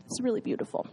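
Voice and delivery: hushed whisper of wonder; whispered, moved by beauty; whispered awe. Real, not acted. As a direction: breathe it out in awe. Moved by God's reciprocity.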